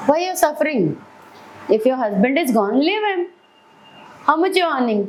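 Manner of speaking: high, insistent scolding friend; a friend urging her, high and insistent; pushy friend. Retold or imitated. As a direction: push her hard to leave him. Friend scolding her over phone.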